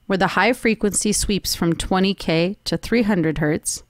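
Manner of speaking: ordinary talk, nothing marked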